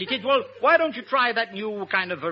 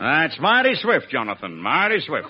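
slow mock-American drawl; lightly hammed-up American drawl; Bob Hope; 6 semitones lower, slower than usual. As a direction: stretch the words in a comic American accent. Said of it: "my impersonation of Bob Hope talking English".

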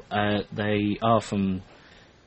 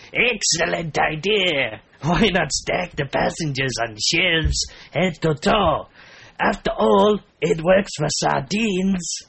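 hammed-up Mexican accent, higher and slower; loud, drawn-out cartoon Mexican accent; 6 semitones higher, 4 dB louder, slower than usual. Reading a Mexico City man's comment aloud, comic Mexican accent. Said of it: "Should I do my Mexican accent?".

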